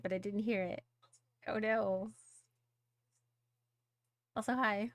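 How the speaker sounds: ordinary talk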